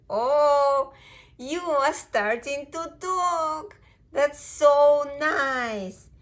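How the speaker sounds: high, loud, drawn-out gushing; thrilled, cooing-loud dog mother; delighted mama dog. Acted. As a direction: gush slowly over a baby's first words.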